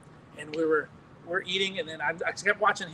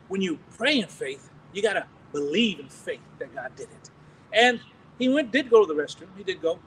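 normal talking voice

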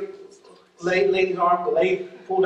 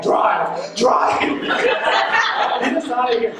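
urgent drive call; 4 semitones higher, much slower than usual. Retold or imitated. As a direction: hurry the driver off, laughing. Urging escape past checkpoint.

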